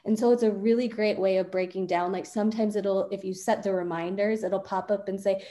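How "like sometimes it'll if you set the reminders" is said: normal talking voice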